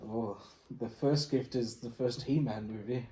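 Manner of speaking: ordinary delivery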